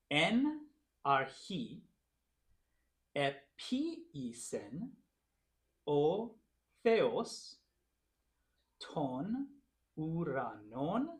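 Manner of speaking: slow, word by word with pauses; slow demonstration reading. Shown as a demonstration. Teacher reading Greek aloud for the class to follow, slow and deliberate. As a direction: read it out very slowly, stretching each word. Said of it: "so just listen, and then we'll break it up".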